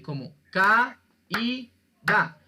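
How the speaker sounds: ca y da said slowly, syllable by syllable; syllable split. Shown as a demonstration. Teacher to class, slowly splitting ca y da. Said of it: "la que es cerrada lleva tilde".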